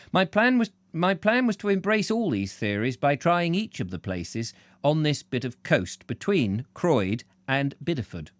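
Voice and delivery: ordinary delivery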